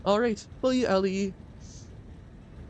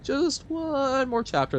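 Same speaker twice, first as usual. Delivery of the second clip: mock pleading reader; 14 semitones higher, slower than usual. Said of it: "Person that's like".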